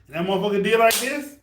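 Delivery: usual speaking voice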